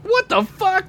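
very high stunned disbelief; stunned squeal. Real, not acted. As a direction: squeal it in total shock. Bigger shocked reaction.

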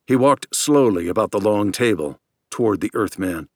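usual speaking voice

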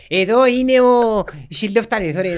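mock tour guide. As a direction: present each exhibit like a proud guide. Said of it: "έκαμε μας το ξεναγό".

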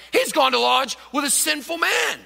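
a loud, high, indignant shout; complaining crowd. Acted. The crowd shouts its complaint about Jesus and the sinner, indignant. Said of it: "began to complain".

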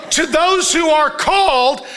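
high, slow, drawn-out proclamation; emphatic scripture reading. Real, not acted. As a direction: ring each phrase out slowly over the congregation. Reading scripture aloud to the church.

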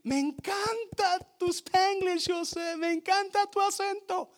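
high, excited mock-gushing coworker; delighted praise. Coworker applauding his Spanglish, high delighted gushing. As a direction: gush over his accent in a high voice. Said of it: "empieza a aplaudir".

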